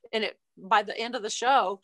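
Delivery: usual speaking voice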